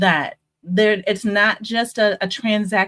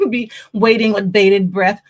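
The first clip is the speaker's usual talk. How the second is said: laughing through the words, louder; 3 semitones higher, 5 dB louder than usual. Real, not acted. Host joking about eager listeners, laughing. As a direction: giggle out the joke about waiting.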